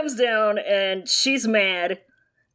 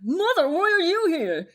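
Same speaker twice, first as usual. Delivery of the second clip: whiny son voice; 6 semitones higher than usual. Acted. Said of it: "I'm Jin Zixun".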